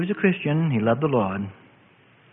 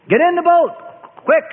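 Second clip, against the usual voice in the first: urgent shout; 11 semitones higher, 5 dB louder than usual. Acted. Warden shouting from motor launch.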